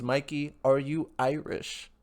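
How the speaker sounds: mock Irish lilt reading a chat question; terrible Irish accent. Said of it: "that's a terrible Irish accent".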